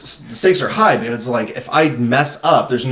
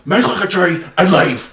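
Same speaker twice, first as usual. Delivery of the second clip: higher mock-exasperated correction; scolding old man; 6 semitones higher than usual. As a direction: imitate a cranky man correcting a botched saying. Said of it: "he starts hitting him with the head".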